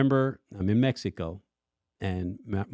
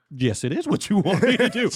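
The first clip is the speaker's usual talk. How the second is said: defiant retort; 9 semitones higher, 5 dB louder than usual. Retold or imitated. Arguing back with God.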